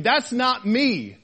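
slow, stretched THAT'S NOT ME; God's firm denial. Acted. God telling Elijah amid the noise, firm denial. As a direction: draw out "not me", each word weighted. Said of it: "God is saying".

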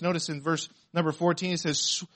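normal talking voice

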